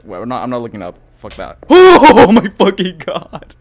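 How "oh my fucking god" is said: loud, slow startled exclamation; louder, drawn-out shock; shaken outburst. Real, not acted. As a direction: let the scare burst out. Startled by a cracking noise.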